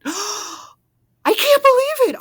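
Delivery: high, loud, slow disbelief; gasping in shock. A narrator's reading. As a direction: gasp the words out, stunned. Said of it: "Arnie gasped".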